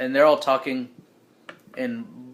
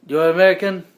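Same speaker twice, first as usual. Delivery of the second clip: attempted British accent, higher and louder; 5 semitones higher, 9 dB louder than usual. British soldier challenging the players, attempted British accent. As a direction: put on a shaky-at-best British accent. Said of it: "what's a British accent?".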